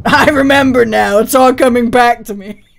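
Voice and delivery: loud laughing realization. Real, not acted. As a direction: laugh it out in dread. Laughing dread at memory.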